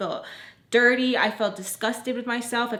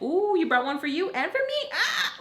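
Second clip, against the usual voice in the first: mock flirty gushing; 9 semitones higher than usual. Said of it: "I wasn't like".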